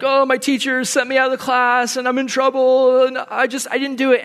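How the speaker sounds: put-on high-pitched childish griping; mock complaining. Schoolboy complaining to his parents about teacher, high mock griping. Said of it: "I would complain".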